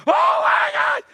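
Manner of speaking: very high, loud imitated shriek; mock panicked scream. Daughter seeing dad's gushing blood, hammed-up scream.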